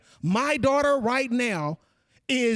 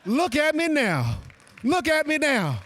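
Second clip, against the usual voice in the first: triumphant shout; 5 semitones higher than usual. Retold or imitated. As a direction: throw it back in the counselor's face. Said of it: "so I can say".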